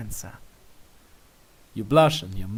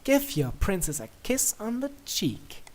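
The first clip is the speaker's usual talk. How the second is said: playful command; 7 semitones higher than usual. Acted. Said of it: "she commands".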